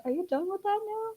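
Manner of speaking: gentle Jesus voice. Retold or imitated. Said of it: "it's like".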